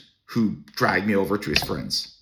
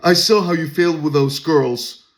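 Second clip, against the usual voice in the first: condescending guy; 7 semitones higher, 6 dB louder than usual. Retold or imitated. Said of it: "this mocking face".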